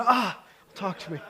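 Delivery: loud, high mock plea to be heard; pleading. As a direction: beg your spouse to talk. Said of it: "never happened in my house".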